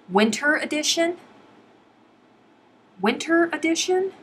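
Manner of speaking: high, loud eager question; thrilled disbelief, voice jumping up; can't-believe-it excitement; excited. Real, not acted. Excited reaction to new magazine.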